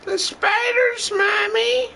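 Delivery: put-on high shrieking child voice; terrified child crying out. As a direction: shriek for Mommy in terror. Cries out to Mommy again.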